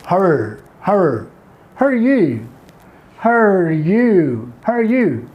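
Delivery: "her you" for how are you, run together and reduced; American short form. Shown as a demonstration. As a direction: say "her you" with "how are" squeezed into one quick syllable. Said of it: "how American pronounce in short form".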